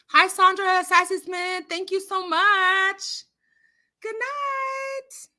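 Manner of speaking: high, drawn-out thanks and goodbye; sing-song greeting. Real, not acted. Host thanking a viewer who is leaving, sing-song.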